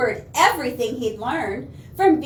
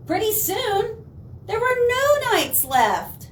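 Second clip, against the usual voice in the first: pitched up high and slowed for suspense; rising suspense; 8 semitones higher than usual. A narrator's reading. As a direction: build the dread higher and slower. Dramatic climax read aloud.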